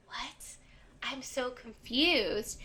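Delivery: soft, slow, baffled voice; retold confusion. Doctor meets Miss Parker, quietly confused. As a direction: imitate someone quietly at a loss.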